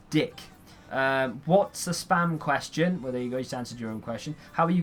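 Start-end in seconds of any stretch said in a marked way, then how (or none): none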